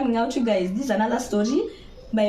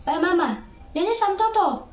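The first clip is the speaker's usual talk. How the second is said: rude order; 9 semitones higher than usual. Retold or imitated. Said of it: "he was talking rudely to me".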